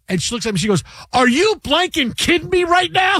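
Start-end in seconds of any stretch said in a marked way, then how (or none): 1.1-3.2 much higher, shouted outrage; furious wife. Retold or imitated. Wife in labor yelling at her yawning husband, furious shout. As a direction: yell it like a wife in labor who's had enough.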